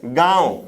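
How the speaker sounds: गांव as gãav, long aa through nose and mouth; गांव, vowel itself nasalized. Shown as a demonstration. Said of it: "तो अनुनासिक".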